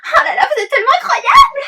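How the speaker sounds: high, loud, gushing with laughter. Acted. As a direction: gush like a starstruck fan. Gushing admiration. (admirative et surexcitée)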